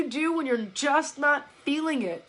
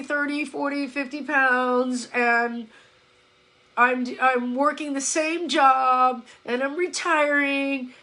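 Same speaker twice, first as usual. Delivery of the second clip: imitating weary life recital. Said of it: "it sounds something like".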